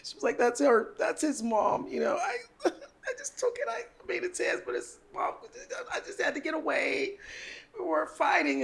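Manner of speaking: high, whiny fake sob story with a laugh breaking through; fake crying. Friend lying to the cop about a boyfriend fight, hammed-up fake tears. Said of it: "I'm acting like I'm wiping her ear and I'm crying".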